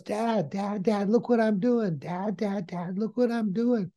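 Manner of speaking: put-on high, insistent child calling for Dad; excited little boy. Little boy showing his dad the toy, eager. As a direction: pester Dad for attention like a small kid. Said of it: "And he goes".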